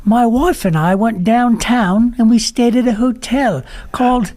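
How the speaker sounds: a poor Dickens orphan grateful for a bed; Oliver voice. Pretend. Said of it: "The Oliver voice".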